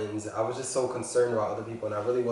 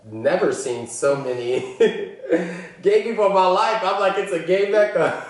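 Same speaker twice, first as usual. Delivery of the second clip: excited laughing; 8 semitones higher, 8 dB louder than usual. Real, not acted. Laughing excitedly about Atlanta.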